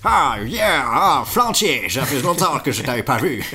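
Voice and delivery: high, loud, slow and laughing; cocky pigeon greeting. Acted. John Mac Pigeon greets Flantier, high, loud and slow. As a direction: stretch the hello like a show-off bird.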